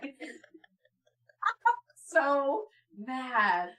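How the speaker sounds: usual speaking voice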